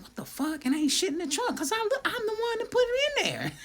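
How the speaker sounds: very high-pitched incredulous squeal; baffled disbelief. Retold or imitated. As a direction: retell your own panicked confusion in a squeaky voice. Acts out own baffled panic.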